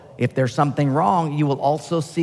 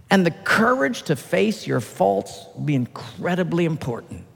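normal talking voice

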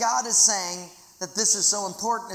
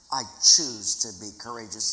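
ordinary talk, nothing marked

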